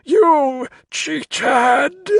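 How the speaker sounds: high, loud, strangled accusation; gurgling. A narrator's reading. Carstairs shrinks into himself accusing Crow, gurgling outrage. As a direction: screech it through a choked throat. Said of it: "Carstairs gurgled".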